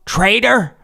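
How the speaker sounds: high, loud furious shout; screamed insult. Acted. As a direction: hurl it at the boy. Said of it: "Father Tuft screamed".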